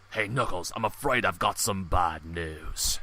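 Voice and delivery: loud, low, slow bad-news announcement; put-on character voice. Host reading the topic to Knuckles in a put-on voice. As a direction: drop low and draw it out grandly. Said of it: "one of my voices right here".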